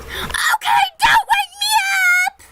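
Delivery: a silly high put-on voice for him; mock grandpa. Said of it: "He was like".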